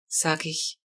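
ordinary delivery

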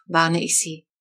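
usual speaking voice

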